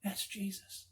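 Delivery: quiet stunned recognition; to himself. Retold or imitated. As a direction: breathe it in awe. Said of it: "he says to himself".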